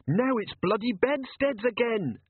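slow, high-pitched wailing complaint; despairing moan. A narrator's reading. Captain moaning about raining bedsteads, high and despairing. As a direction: lament it slowly, pitched high. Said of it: "moaned Jenkins".

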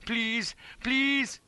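high, strained pleading; effortful high plea; desperate straining prayer. A narrator's reading. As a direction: beg for strength while pushing hard. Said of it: "pushing futilely".

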